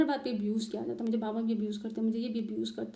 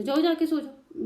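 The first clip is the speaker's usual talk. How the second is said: higher, louder dismissive command; sharp order; 6 semitones higher, 7 dB louder than usual. Retold or imitated. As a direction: send her off curtly. बेटी का हुक्म. (ऊँची आवाज़ में रूखा हुक्म)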